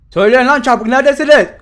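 loud impatient yell; shouting. Real, not acted. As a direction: yell it out, demanding. Yelling at game enemies.

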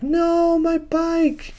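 very high drawn-out wail; hammed-up cry of loss in a squeal; mock anguish. Missile Mouse sees his bike smashed, mock anguish.

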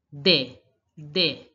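de, de, each a separate falling "day"; accented e sound. Shown as a demonstration. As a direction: model de twice with an "ay" vowel. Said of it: "you have to pronounce it this way".

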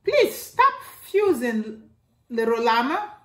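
lightly voiced mother saying enough now; stern mama.

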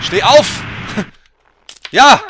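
frantic cheer for the fallen rider; yelling at the character. Real, not acted. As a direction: bellow at him to get up. Yelling at his game character.

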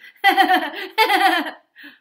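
high, loud cackling laugh; eerie hyena laugh. Acted. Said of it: "laughing eerily".

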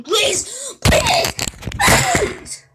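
shrieked plea, very high and loud; desperate begging scream. Real, not acted. Player begging not to lose in the game, desperate screaming. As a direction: escalate the plea into a piercing scream.

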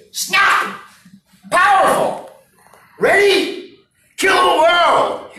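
high, loud power shout; powerful shout. Shown as a demonstration. Coach pumping up the audience, loud powerful shout. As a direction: shout it out like you own the room. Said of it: "I want you to snap into powerful".

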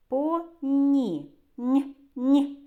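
"По, ні" with a held, palatal N. Shown as a demonstration. Teacher isolates the soft consonant.

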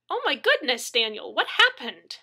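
high, loud shock with swooping pitch; horrified cry. A narrator's reading. Neighbor sees his battered face, horrified cry. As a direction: cry out in alarm. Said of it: "she cried".

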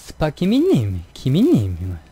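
slow Busan-tone name call; Busan accent. Retold or imitated. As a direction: lightly sing the name in Busan tones. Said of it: "이렇게 가더라고요, 사투리가". (느린 부산 억양 이름 부르기)

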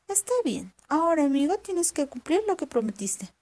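voz de zorra aguda y viva, más alta; zorra astuta. Acted. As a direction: una zorra lista que pregunta con curiosidad. (la zorra pregunta al campesino asustado, voz aguda y curiosa)

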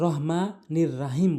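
"রহমানির রাহিম" with light h and open "ra"; common mispronunciation. Shown as a demonstration. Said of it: "যে জায়গায় ভুল করে সেটি".

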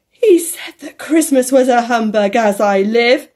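loud laughing outcry; loud cry. A narrator's reading. Nephew cries out about Scrooge's humbug, loudly. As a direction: cry it out with glee. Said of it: "cried Scrooge's nephew".

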